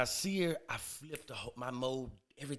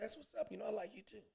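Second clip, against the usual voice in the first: higher, soft voice; coy high reply; 6 semitones higher, 14 dB quieter than usual. Retold or imitated. Man replying to woman on his chest, coy high reply. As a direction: answer softly, pitched up.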